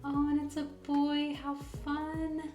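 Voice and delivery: high, loud burst of delight; thrilled gender reveal; excited. Real, not acted. Gender reveal of new baby.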